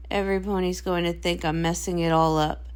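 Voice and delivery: worried and glum; anxious dread. Acted. Sister dreads failing.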